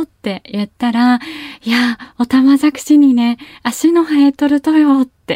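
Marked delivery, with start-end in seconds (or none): 1.6-5.0 higher, louder, shocked report; mock amazed mother. Mother telling daughter about the tadpole legs, amazed. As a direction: play the mother gasping over the tadpole legs. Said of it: "驚いて".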